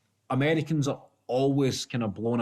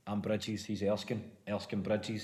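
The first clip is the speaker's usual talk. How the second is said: much softer low chant; hushed schoolboy greeting; 3 semitones lower, 9 dB quieter, slower than usual. Retold or imitated. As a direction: murmur it like a private joke.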